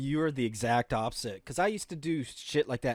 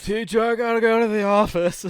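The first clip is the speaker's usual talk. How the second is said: higher, louder put-on kid voice; a hammed-up schoolboy excuse; mock whiny student; 7 semitones higher, 7 dB louder than usual. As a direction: play the kid begging to leave class. Mocking the kid leaving class.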